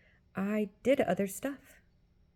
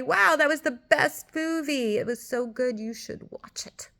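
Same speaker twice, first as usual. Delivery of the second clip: gushing, louder mock praise of a movie; mock enthusiastic; 6 semitones higher, 5 dB louder than usual. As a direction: ham up a fan recommending a film. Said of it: "telling everybody".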